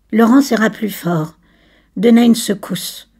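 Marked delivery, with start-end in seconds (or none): none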